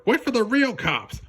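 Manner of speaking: high put-on voice, scornful call; mocking cop. Cop sneering at Judy to wait, high mocking voice. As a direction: taunt the little rookie. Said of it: "one of the cops called".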